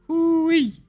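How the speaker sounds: very high cowboy "hoo-wee"; high whoop. Pretend. Streamer astonished at the film's age, high whoop. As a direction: whoop it way up high, southern style.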